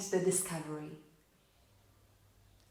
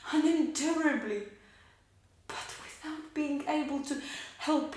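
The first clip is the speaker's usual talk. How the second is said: higher, swelling, anguished confession; anguished outpouring; 6 semitones higher, 4 dB louder than usual. Acted. Widow confessing her helpless love for her husband, anguished.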